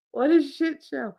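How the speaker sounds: ordinary talk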